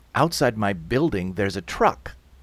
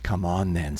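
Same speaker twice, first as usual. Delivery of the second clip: indifferent official; 5 semitones lower than usual. Acted. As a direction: dismiss them in a low, unmoved voice. Yellow vests answer shouting owners.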